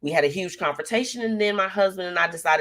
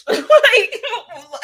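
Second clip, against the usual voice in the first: big high burst of real laughter; loud, high cackle of real laughter; 10 dB louder than usual. Real, not acted. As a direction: let a loud laugh explode.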